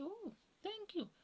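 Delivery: high, soft, drawn-out thank-you; retold sweet thanks. Woman accepts compliment on her beauty, high soft retold thanks.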